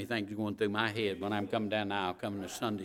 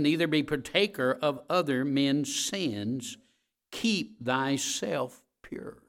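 scripture reading; 2 semitones higher, 5 dB louder than usual. Acted. Preacher reading scripture to congregation, solemn. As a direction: read the King James words with weight.

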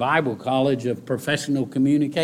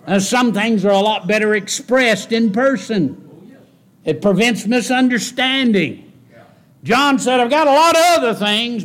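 high, loud, fervent sermon delivery; preaching at full force; 9 semitones higher, 5 dB louder than usual. Acted. Preacher builds again.

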